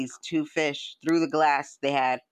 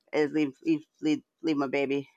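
ordinary talk, nothing marked

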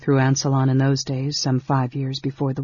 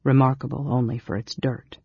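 usual speaking voice

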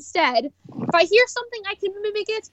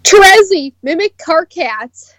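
loud dare; 12 dB louder, slower than usual. Retold or imitated. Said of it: "one of the dares".

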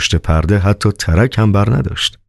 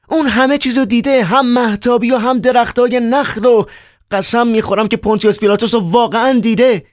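put-on much higher, agitated young man; Ivan's voice. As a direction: play Ivan, high and worked up. Narrator voices Ivan.

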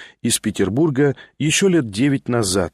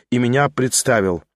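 ordinary delivery

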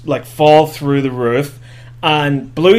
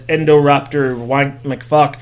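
ordinary talk, nothing marked

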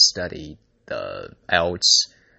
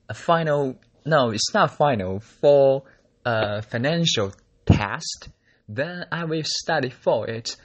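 higher-pitched, halting try at a British accent; attempted British accent; 6 semitones higher, 4 dB louder than usual. Shown as a demonstration. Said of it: "I will do some accent".

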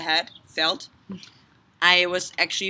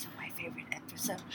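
whispering; 2 semitones lower, 18 dB quieter, faster than usual. Real, not acted. Whispering game continues.

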